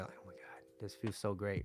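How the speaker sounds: very soft, low sigh of relief; hushed, pretend bliss; acted contentment. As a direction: play a soothed partner melting into comfort. Acting the comforted girlfriend's relief.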